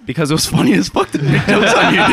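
high-pitched talking through laughter; laughing hard. Real, not acted. Friend justifying jokes made on his buddy, laughing. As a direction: crack up while explaining.

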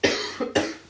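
short dry cough. Real, not acted. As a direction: clear the throat with a cough. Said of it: "sorry, one more to cough".

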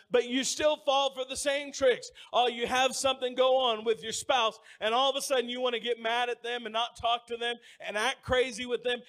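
normal talking voice

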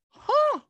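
high, loud mock gasp; acted sudden "huh" of alarm; startled gasp. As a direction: gasp sharply, as if seeing a fin. Acts out startled reaction to fin.

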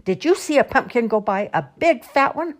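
high, loud bawled question; bawling. A narrator's reading. Old man questions a varmint about the pumpkin, bawling. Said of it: "bawled out".

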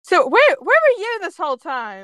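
high-pitched, incredulous demand; loud, mock-outraged questioning; indignant scolding. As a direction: call out someone who failed to help. Reader scolds character mid-story.

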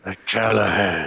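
slow gritty tough-cop voice through the teeth; Clint Eastwood. Pretend. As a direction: growl it like a hard-boiled movie cop. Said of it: "you sound like Clint Eastwood now".

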